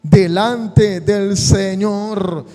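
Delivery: loud, sustained pulpit proclamation; rousing sermon crescendo; preaching at full force. Acted. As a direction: preach it to the back row with fire.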